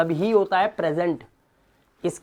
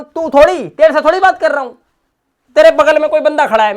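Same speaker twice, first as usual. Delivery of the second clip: loud, fast, higher mock telling-off; mock scolding; 8 semitones higher, 10 dB louder, faster than usual. Teacher scolding a student, loud fast mock telling-off. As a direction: snap at the student for not getting it.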